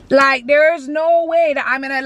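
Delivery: usual speaking voice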